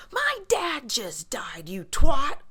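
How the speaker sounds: slow, then a loud hissed twat; imitating Lala. Quoting Lala snapping at the Bambi-eyed girl: mock venomous. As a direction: quote Lala's rant, spitting the insult. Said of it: "Lala's infamous quote where she's like".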